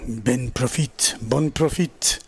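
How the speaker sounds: French accent. Shown as a demonstration. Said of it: "trying to commentate with a different accent".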